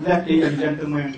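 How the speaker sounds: put-on father of the bride in a Quảng Nam accent. Father toasts wedding guests in Quảng Nam-accented English. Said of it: "nói tiếng Anh mà ông nói bằng giọng Quảng Nam".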